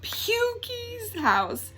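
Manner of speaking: high, slow, drawn-out groan of the name; disgusted drawl. Acted. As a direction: stretch the name out like it's gross.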